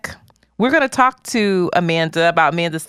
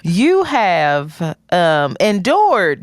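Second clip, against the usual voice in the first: slow dramatic; 3 semitones lower, much slower than usual. Acted. Starts interview dramatically.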